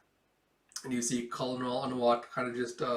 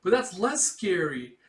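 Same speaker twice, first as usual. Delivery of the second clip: pouty; 6 semitones higher, 7 dB louder than usual. Retold or imitated. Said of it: "puts on a little pouty face".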